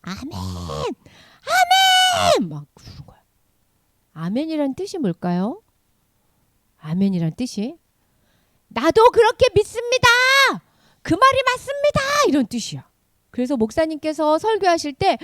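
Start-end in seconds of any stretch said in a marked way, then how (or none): 0.0-2.5 drawn-out cries of amen; crowd amens. Acted. People here and there responding to the word, fervent amens. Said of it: "여기저기서". 8.7-12.0 high, emphatic declaration of belief; fervent affirmation. Shown as a demonstration. Teacher showing children what amen means, fervent affirmation.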